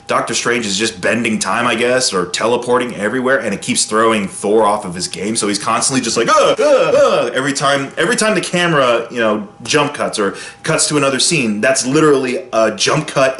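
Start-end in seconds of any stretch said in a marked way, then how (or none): 6.3-7.3 startled uh-uh-uh, much higher and louder; imitating Thor's confused sputter; flustered stammer. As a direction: caught off guard mid-thought. Thor thrown off by teleporting.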